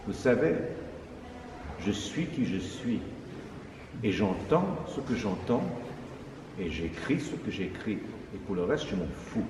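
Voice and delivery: plus bas, plus doux et lent; réplique posée de Messiaen. Retold or imitated. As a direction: laisse tomber chaque phrase calmement. Messiaen répond calmement, sans détour. (Messiaen imité, calme et tranchant)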